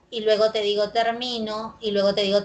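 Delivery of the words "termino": "termino" stressed on MI, ter-MI-no; stress on middle syllable. Shown as a demonstration. Teacher contrasting accents for students, "termino" stressed mid-word. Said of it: "aquí observamos oposición del acento".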